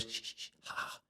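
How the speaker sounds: hissing, voiceless whisper noises; imitated whispers. Voices in his head start again, whispering. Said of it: "very loud whispers".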